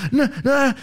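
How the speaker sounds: panicked denial. Retold or imitated. Panicked neighbour answering.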